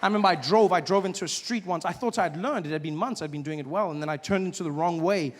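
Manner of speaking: normal talking voice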